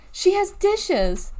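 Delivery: high, slow, drawn-out whine; whining. Acted. Galveston whines that Deli has dishes, high and drawn-out. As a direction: stretch the complaint out. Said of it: "Gal cried".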